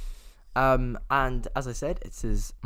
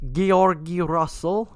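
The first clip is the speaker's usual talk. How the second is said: slow, higher put-on Soviet Union accent; hammed-up Russian-style name drop; playful Soviet Union accent, louder; mock Soviet accent; 6 semitones higher, 6 dB louder, slower than usual. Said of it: "into Soviet Union accent mode".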